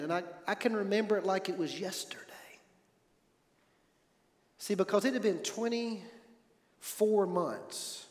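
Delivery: real emotion, whispered and fading; hushed whisper. Real, not acted. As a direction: let the words fall to a whisper as the memory lands. Remembering holding her hand again.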